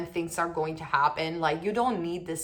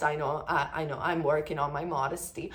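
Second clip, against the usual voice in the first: usual speaking voice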